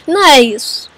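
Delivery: ordinary talk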